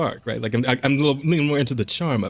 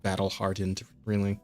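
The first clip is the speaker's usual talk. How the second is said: softer, scoffing repeat of the phrase; quiet sneer at the article's wording; mocking; 5 semitones lower, 8 dB quieter than usual. Real, not acted. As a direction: scoff at the writer's words. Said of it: "mocking the article's words".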